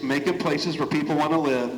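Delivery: usual speaking voice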